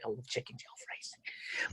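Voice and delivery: low, very soft whisper; a hushed, joking aside. Real, not acted. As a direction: confess it under your breath. Joking aside about favourite dish.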